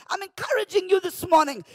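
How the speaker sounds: normal talking voice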